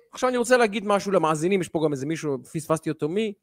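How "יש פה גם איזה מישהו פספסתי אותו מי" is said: usual speaking voice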